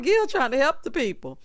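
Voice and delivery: ordinary delivery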